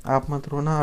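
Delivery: usual speaking voice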